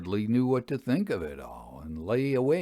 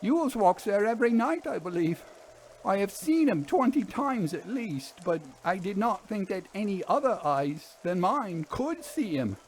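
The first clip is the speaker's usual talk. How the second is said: higher voice of an uneasy railwayman; haunted engine driver; 10 semitones higher than usual. Acted. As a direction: play the driver, set apart from the narrator, pitched up. Fern speaks to narrator.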